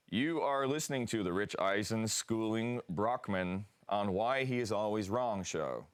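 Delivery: put-on American announcer accent. Shown as a demonstration. Australian guest reading the new show's official line, American accent. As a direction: read it in your best American accent. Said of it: "your best American accent".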